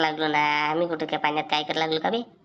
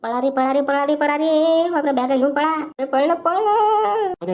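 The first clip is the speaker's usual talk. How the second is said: high, loud cry to run; panicked, drawn-out shouting; shouted alarm; 8 semitones higher, 6 dB louder, slower than usual. Acted. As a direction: yell to friends to flee, stretching the words. Children shout to flee from owner.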